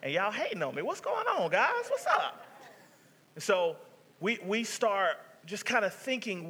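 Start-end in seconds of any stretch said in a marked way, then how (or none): 0.8-2.5 high, breezy hey guys; playful high-pitched greeting; mock casual greeting. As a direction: toss off a light mock hello.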